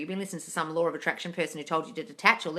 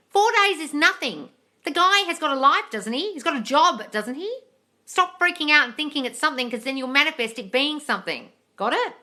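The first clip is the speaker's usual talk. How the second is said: higher and louder, real frustration pouring out; exasperated rant; 5 semitones higher, 6 dB louder than usual. Real, not acted. Coach scolds follower panicking after four days, loud and exasperated. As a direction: tear into them for panicking.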